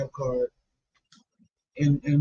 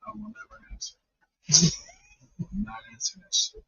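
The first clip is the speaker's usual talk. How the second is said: whisper; 8 semitones higher, 7 dB quieter than usual. Real, not acted. Whispered joke reply.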